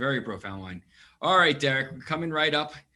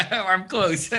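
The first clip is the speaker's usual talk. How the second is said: laughing; 7 semitones higher, 7 dB louder than usual. Real, not acted. Laughing at a muted guest.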